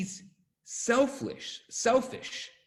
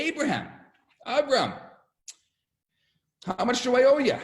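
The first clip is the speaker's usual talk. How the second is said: raised, lively voice of a guest; satisfied guest calling out; 8 semitones higher, 6 dB louder, faster than usual. Retold or imitated. Guests to Abraham after a great meal, lively calling out. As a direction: call to the host for the bill. Guests hail their host to pay.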